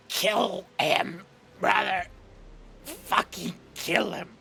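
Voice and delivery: choking brother begs for the kill; choked plea. Acted. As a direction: beg while being strangled.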